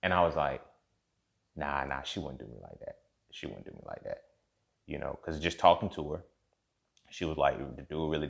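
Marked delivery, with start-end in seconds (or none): 1.5-4.2 fading to a hushed murmur; quiet disbelief. Retold or imitated. Man reassuring himself, trailing into a whisper. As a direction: talk yourself out of it, softer and softer.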